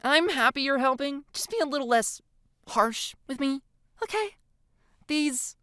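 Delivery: high, pleading whine; whining. A narrator's reading. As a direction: plead in a whiny, high girl voice. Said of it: "she whines out".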